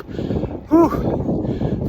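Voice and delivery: high, airy exhale of relief; breathless whew. Real, not acted. Rider at the top of a steep climb, breathless whew. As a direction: blow it out like you just crested the hill. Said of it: "out of breath".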